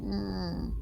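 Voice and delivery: high, soft mournful hum; sad whimper. Acted. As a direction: whimper quietly through closed lips. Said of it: "Sad Thorgrim noises".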